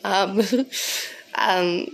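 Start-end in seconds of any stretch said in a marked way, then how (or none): 0.4-1.1 short snort of laughter. Real, not acted. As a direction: laugh with a snort through the nose. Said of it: "I snort when I laugh".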